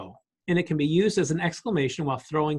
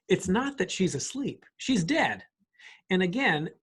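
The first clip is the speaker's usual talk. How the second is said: ordinary talk, nothing marked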